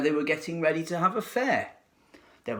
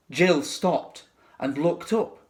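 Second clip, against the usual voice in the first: usual speaking voice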